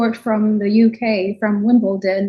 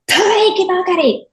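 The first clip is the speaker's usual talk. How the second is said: retold outburst; 8 semitones higher than usual. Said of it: "she turned and she went".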